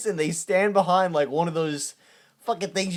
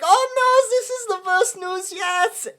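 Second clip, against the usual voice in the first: high, slow hammed-up dismay; John Boy mock-mourning the worst news; dramatic despair; 13 semitones higher, slower than usual. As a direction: overplay the tragic hero's lament. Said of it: "And John Boy's like".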